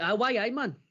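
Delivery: high, loud mock Geordie reply; a cheery Geordie "why aye man"; Geordie Waddle. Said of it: "he's like".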